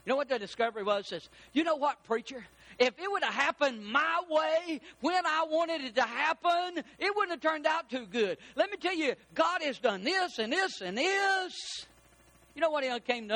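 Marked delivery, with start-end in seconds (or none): 1.5-11.9 higher, brighter retelling of a grateful realization; excited realization. Retold or imitated. Preacher voices a churchgoer telling him what God did, excited.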